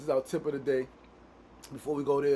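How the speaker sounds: ordinary talk, nothing marked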